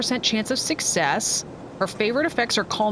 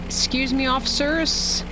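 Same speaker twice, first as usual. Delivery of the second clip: calling out; 8 semitones higher than usual. Acted. Calling to officers while driving past.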